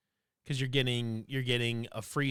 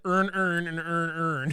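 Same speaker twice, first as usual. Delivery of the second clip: put-on louder, higher Baltimore accent, every word flattened to "earn"; imitated Baltimore accent; 5 semitones higher, 5 dB louder, slower than usual. Retelling a short about Baltimore speech, imitated Baltimore accent. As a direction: mimic Baltimore, merging all the words into "earn". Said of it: "the Baltimore accent's hilarious".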